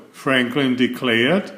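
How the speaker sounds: plain reading voice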